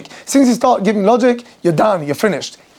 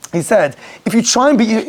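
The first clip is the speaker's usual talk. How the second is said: normal talking voice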